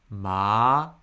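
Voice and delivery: ma rising from low upward; second tone. Shown as a demonstration. Teacher gives ma the second tone, rising. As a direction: lift ma like a question.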